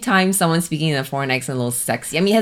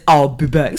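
Arnold; 2 semitones lower, 5 dB louder than usual. Shown as a demonstration. Host contrasting with Singaporean speech, Arnold impression. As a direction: do the Terminator's heavy accent. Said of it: "not the same as".

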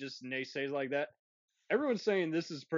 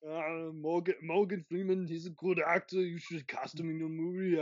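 Arnold Schwarzenegger voice. Retold or imitated. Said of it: "if Arnold had".

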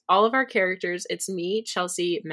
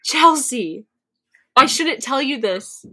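higher and louder, building excitement; can't hold the news in; excited announcement; 6 semitones higher, 6 dB louder, slower than usual. Real, not acted. Telling her friend the dinosaur hatched, bursting with excitement.

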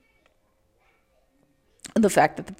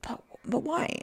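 plaintive why; 11 semitones higher, 6 dB quieter than usual. Retold or imitated. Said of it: "it's like".

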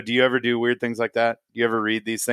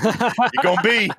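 much higher, slow, laughing preacher echo; hammed-up Baptist preacher; 10 semitones higher, slower than usual. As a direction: stretch it out like a revival preacher, laughing. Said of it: "That Baptist flair just came out".

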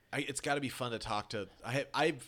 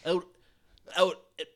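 put-on old Brooklyn accent. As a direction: drawl it like a Brooklyn old-timer. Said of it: "Old-timey Brooklyn".

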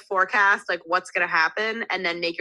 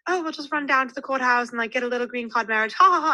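higher-pitched mock British voice with a fake laugh; attempted British accent; 6 semitones higher, faster than usual. British date joking about a green card marriage, mock British accent. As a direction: put on a British accent, end on a hammy "ha ha ha". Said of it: "I was gonna try to do a British accent".

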